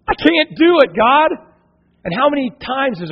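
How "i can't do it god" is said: high, louder pleading cry to God; desperate cry of giving up. Retold or imitated. A believer crying out to God that he cannot do it, high and desperate. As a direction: throw up your hands and wail at God.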